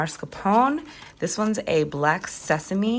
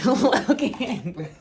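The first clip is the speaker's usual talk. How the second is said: laughing; 5 semitones higher, 5 dB louder than usual. Real, not acted. Teasing partner blinding her.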